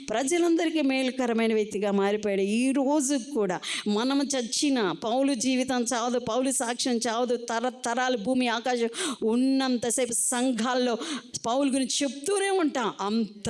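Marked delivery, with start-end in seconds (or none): none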